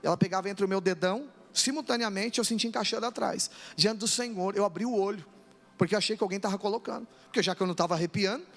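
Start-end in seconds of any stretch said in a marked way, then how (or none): none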